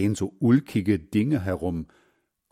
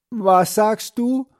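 put-on much higher, louder, slow old man straining to hear; hard-of-hearing grandfather; 12 semitones higher, 6 dB louder, slower than usual. A narrator's reading. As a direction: ask like a deaf old man. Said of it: "hält die Hand hinters Ohr".

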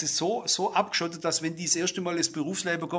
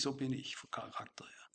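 whispered aside; 6 semitones lower, 18 dB quieter than usual. Real, not acted. Aside on his own temper.

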